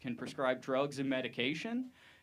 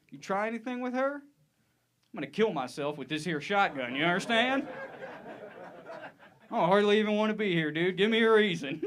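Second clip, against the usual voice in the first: intimidating dad; 6 semitones higher, 3 dB louder than usual. Acted. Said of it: "how could I scare him?".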